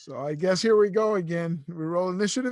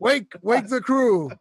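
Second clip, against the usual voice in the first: raised, drawn-out call to the crew; 4 semitones higher, 4 dB louder, slower than usual. Acted. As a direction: rouse the crew with a slow, urgent call. Player calls out to rouse crew.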